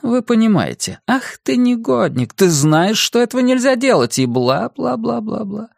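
high scolding owner voice; scolding woman. Acted. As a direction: nag the naughty dog. Julia scolding dog.

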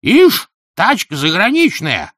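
put-on slow, high elderly grumbler; old person's voice. A narrator's reading. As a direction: drawl it high like a disapproving oldster. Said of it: "старческий".

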